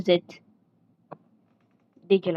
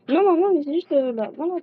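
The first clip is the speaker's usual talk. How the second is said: high, louder protest to mum; object, pitched way up; protesting child; 9 semitones higher, 4 dB louder than usual. Acted. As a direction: kid protesting her mother's outfit.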